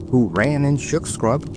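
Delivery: usual speaking voice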